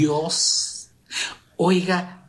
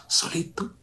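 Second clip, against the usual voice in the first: whispered; 5 semitones higher, 6 dB quieter than usual. Shown as a demonstration. Alone with his father.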